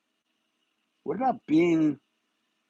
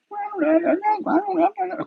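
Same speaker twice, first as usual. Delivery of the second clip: flustered wife; much faster than usual. Pretend. Imagined wife Katie flustered answer.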